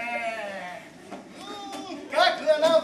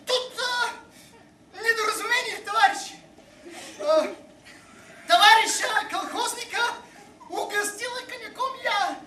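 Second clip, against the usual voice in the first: flustered; 7 semitones higher than usual. Acted. Flustered defence before passengers.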